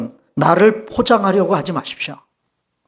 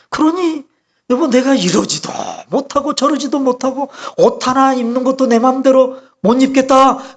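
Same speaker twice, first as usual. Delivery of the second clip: higher, mock-plaintive wife's grumbling; retold plaintive complaint; 7 semitones higher than usual. Pastor's wife complaining to her husband, retold plaintively. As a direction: imitate a wife pouring out her woes. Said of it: "그러고 하소연을 했어요".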